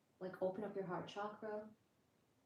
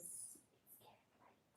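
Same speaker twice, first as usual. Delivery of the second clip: very hushed, breath-only whisper; exaggerated late-night whisper; playful whisper; 24 dB quieter than usual. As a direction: drop to a tiny whisper, as if others are asleep. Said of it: "but I have to whisper".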